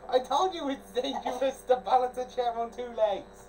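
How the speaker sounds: loud, high told-you-so; laughing scolding. Real, not acted. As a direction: scold loudly. Scolding after fall.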